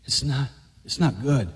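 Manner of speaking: lightly voiced devil sowing doubt; tempting devil. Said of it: "whispered in his ear".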